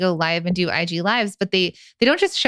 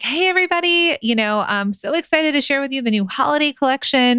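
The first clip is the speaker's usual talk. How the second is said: high, sing-out hype greeting; anti-influencer sales voice; 7 semitones higher than usual. Acted. Host greeting buyers on a live sale, hype sales voice. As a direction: pitch it up like a hype girl. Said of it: "that's, like, my anti-influencer voice".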